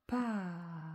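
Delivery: "Par." stretched slowly, long A into long R; drawn-out syllable. Shown as a demonstration. Teacher sounding out the word while writing, drawn-out syllable. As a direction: sound out "Par." without a break, holding each sound. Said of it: "Je ne m'arrête pas entre".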